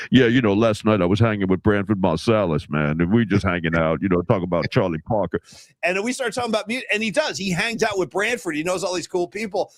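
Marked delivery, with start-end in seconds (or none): 0.1-5.4 imitating a deep-voiced sports reporter; Mark Spears deep voice. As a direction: drop way down into his deep voice. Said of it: "'cause he's got that deep voice".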